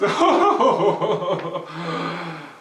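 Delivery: a loud dismissive laugh; scoffing laugh. Acted. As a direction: laugh off the threat. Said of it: "Herschel laughed".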